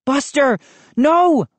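high cry of alarm, building on the last word; screamed. A narrator's reading. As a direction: cry out to stop the dog. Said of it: "I screamed".

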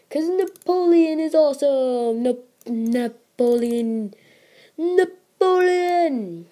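drawn-out high hero cheer; excited cheer. Real, not acted. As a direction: stretch the name out in glee. Cheering his favourite unit.

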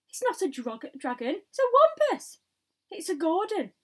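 high, loud argument over the monster; panicked shouting. A narrator's reading. Policemen shouting at once about the creature, panicked shout. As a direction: shout over the others with your guess. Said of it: "all started shouting at once".

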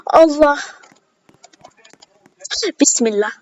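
ordinary delivery

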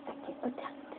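soft unvoiced aside; whispered. Real, not acted. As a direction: whisper it to yourself. Whispered aside before challenge.

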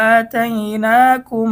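আতাইনাকুম slowly, the ম nasalised; slow recitation with ghunna. Shown as a demonstration. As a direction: draw out আতাইনাকুম in chant. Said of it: "মিম সাকিনের ইকফা গুন্না সহ পড়তে হবে". (গুন্না সহ ধীরে তিলাওয়াত)

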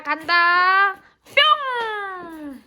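slow, drawn-out playful call; playful stretched call. Real, not acted. Owner throwing the toy for her dog, drawn-out playful call.

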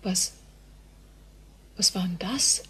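hushed, breathless whisper of alarm; frightened whisper. Acted. Helen reacting to the eerie voices, frightened whisper. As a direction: whisper it, scared of being heard. Girl startled by strange voices.

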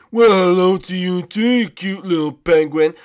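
loud cartoon princess greeting; Raggedy Princess. Princess greets the shy penguin, cartoon princess voice. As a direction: coo loudly in a put-on princess voice. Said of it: "Gunter's a little shy around you".

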